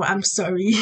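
ordinary talk, nothing marked

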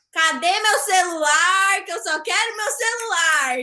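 slow, drawn-out dramatic plea; retold whiny demand. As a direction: stretch it into a put-on plaintive demand. Acts out demanding her phone from director.

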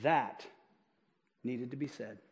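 low, slow-paced hushed quote with long pauses; quivering lip, imitated. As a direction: imitate a man holding back emotion, low and soft. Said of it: "with a quivering lip".

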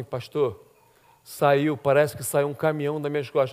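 low, soft confiding voice; relieved woman, retold. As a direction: imitate someone quietly unburdened.

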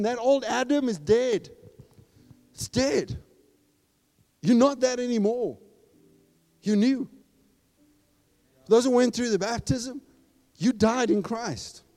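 high, loud, driving preacher voice; fiery preaching. Acted. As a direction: preach it hard to the crowd. Preaching at full force.

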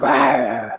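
guttural undead moan; zombie groan. Retold or imitated. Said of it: "making the zombie noises".

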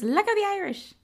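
high-pitched first stab at the grandmother; quick, high quote of an Irish granny; mock Irish grandmother. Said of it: "Not like that, but she's like".

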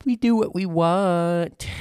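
high, loud, slow silly voice; goofy put-on voice. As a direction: drawl it out like a goof. Goofy declaration.

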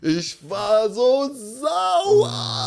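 high, very drawn-out cry; exaggerated outrage. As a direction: stretch it into a hammed-up furious wail. Comic outburst at remembered anger. (gedehnter, hoher, gespielt wütender Aufschrei)

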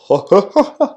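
mock maniacal cackle; imitated maniacal laughter. Podcaster imitating laughter heard at show's end, mock maniacal. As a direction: fake a villain's crazed laugh. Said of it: "Like the, the maniacal laughter".

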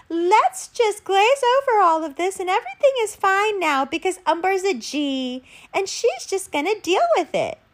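high, sing-song mock cheeriness. Voicing people who want it all glossed over, high mocking voice. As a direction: put on a high, glib smoothing-over voice.

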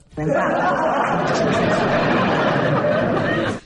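breathy high giggle; laughing. Real, not acted. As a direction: burst out laughing. Laughing at wife's sleep talk.

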